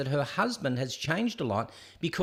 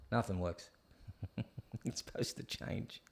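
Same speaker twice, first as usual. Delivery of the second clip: whispered under the breath, much softer; muttered whisper; 6 semitones lower, 12 dB quieter than usual. Real, not acted. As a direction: grumble it to yourself in a whisper. Equipment not working, muttering.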